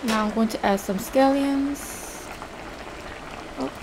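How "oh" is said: normal talking voice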